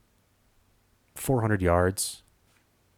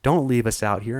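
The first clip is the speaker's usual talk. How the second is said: retold scared yelling; 6 semitones higher than usual. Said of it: "just yelling at me".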